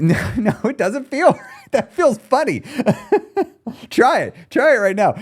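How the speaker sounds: high-pitched, laughing protest. Real, not acted. Coach amused by his failed sad attempt, laughing. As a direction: crack up while objecting.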